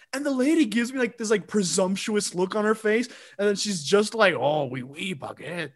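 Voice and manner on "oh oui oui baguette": lower, soft mock French accent; a hint of a mocking French accent, lower and softer. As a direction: sneer it like a dismissive clerk. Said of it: "clearly, like, mocking me".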